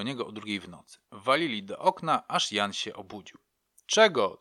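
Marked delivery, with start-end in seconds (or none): 3.9-4.4 gruff loud call through the window. Retold or imitated. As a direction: bark it at the door in the night. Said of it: "zawołał".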